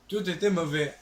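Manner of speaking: high, loud declaration between laughs; loud, amused verdict. Real, not acted. Streamer repeating the verdict amid laughter, loud declaration.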